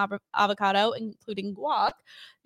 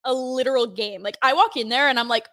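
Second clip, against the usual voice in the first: ordinary delivery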